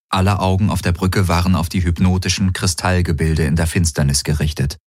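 plain reading voice

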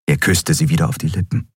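plain reading voice

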